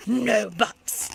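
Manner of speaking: loud cut-off; shouted. Acted. Museum director cutting off the girl, shouting. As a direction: slam the door on excuses. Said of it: "shouted the museum director".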